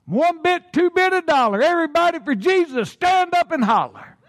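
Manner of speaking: high, fast cheerleader chant. Pretend. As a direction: chant it like a pep rally. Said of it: "to sound like a cheerleader".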